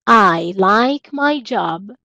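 drawn out word by word; slow teacher demonstration; very slow model sentence. Shown as a demonstration. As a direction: stretch it for the class. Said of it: "teacher models English sentence".

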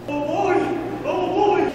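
squeaky high cartoon bird voice; high excited crow voice. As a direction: chirp it out high and eager.

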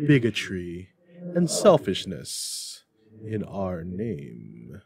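grave, slow, booming ghost voice; the spirit rebuking Scrooge solemnly. Acted. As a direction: pronounce it like a stern judgment.

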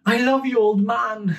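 put-on high, sweet little boy. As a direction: say it like a small child, high up. Child Dimitri voiced in story.

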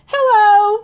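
high, loud sing-song hello; cheery bear greeting. Acted. Cher Bear calls hello to her friend, high and cheery. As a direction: greet like a bubbly cartoon bear.

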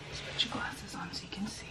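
soft unvoiced whisper. Real, not acted. Soothing sick child quietly.

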